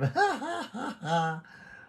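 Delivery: high-pitched run of laughter; real laugh. Real, not acted. Laughs after his Voltes Five greeting. (mataas na halakhak)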